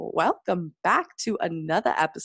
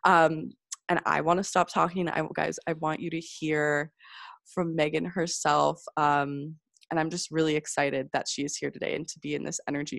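ordinary talk, nothing marked

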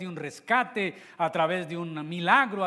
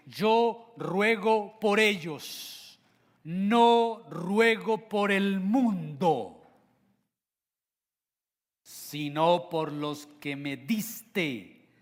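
very slow, leaning hard on ruego and no; slow emphatic prayer reading; 3 semitones higher, 4 dB louder, much slower than usual. Shown as a demonstration. Preacher reading Jesus' prayer slowly, stressing each word. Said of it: "enfatizando una palabra".

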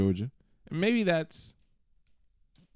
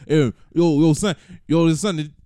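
loud mock New York accent; 4 semitones higher, 9 dB louder, slower than usual. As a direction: yell it like a Bronx guy. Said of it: "a terrible New York accent".

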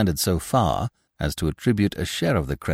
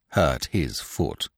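low, slow, dismissive repeat; scornful echo; 6 semitones lower, 4 dB quieter, much slower than usual. Acted. Binet answering his daughter's reproof, scornfully. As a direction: echo it slowly and low in disbelief.

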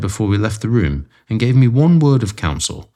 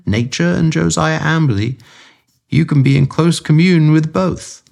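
higher, lightly dry and wry; dry Holmes; 8 semitones higher than usual. A narrator's reading. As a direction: tease with a hidden chuckle. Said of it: "I heard his dry chuckle".

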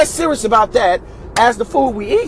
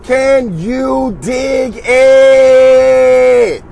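slow stretched-out shout; drawn-out shout; 4 semitones higher, 6 dB louder, much slower than usual. Acted. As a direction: yell it long and slow.

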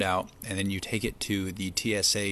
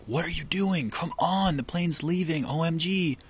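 higher, urgent, hurried pleading; frantic friend; 8 semitones higher than usual. Retold or imitated. Friend at the gate urging him to board, frantic. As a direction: hurry a late friend onto the plane. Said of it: "she was standing there like".

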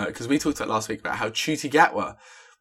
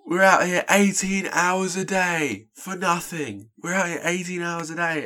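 much higher, slow, drawn-out complaint; aggrieved rapper; 8 semitones higher, slower than usual. Retold or imitated. Rapper complaining to the audience about his long hours, high and slow. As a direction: moan about the grind like a struggling artist. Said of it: "And then he was like".

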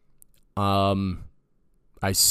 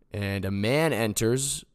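ordinary talk, nothing marked

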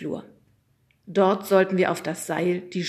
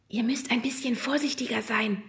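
whisper; 5 semitones higher than usual. A narrator's reading. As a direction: whisper a gentle caution. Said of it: "flüsterte uns die Frau des Lehrers zu".

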